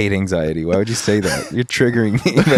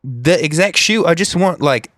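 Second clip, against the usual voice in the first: a light put-on British accent, higher and impatient; 7 semitones higher than usual. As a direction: keep the fake British voice and press for the shoes. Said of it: "a foreign exchange student from Britain".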